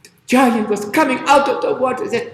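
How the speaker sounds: plain reading voice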